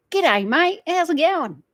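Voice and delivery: mock a nasal Australian greeting, swooping high; nasal Aussie accent. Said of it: "Australians sound nasally".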